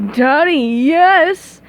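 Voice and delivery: slow mocking villain echo; gloating mockery. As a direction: taunt her mockingly. Plankton mocking Mindy's cry.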